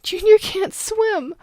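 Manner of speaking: high, loud panicked cry; gasping, terrified child; terrified screaming. Acted. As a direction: scream it out breathless with fear. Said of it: "gasped between her exertion ... terrified sobs".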